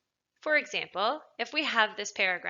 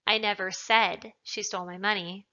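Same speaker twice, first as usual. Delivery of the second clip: "said" punched out from the sentence; emphatic "said", as if it was only implied; stress on SAID; 5 dB louder than usual. Shown as a demonstration. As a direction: hit the word "said". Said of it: "perhaps I implied it".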